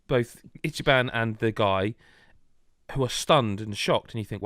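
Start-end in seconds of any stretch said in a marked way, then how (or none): none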